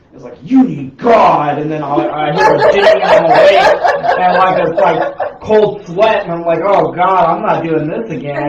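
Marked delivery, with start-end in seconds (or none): 0.4-1.6 slow, loud and eerie; creepy voice. Retold or imitated. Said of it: "there's this creepy-ass voice". 1.6-8.5 excited retelling. Retold or imitated. Retelling a scary dream.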